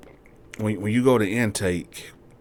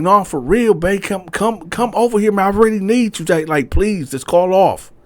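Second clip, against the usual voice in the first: much higher, louder, begging; man begging his girl on the phone, high and loud; pleading boyfriend; 11 semitones higher, 7 dB louder than usual. Retold or imitated. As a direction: beg her urgently to come over. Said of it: "He like".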